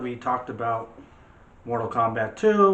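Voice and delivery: usual speaking voice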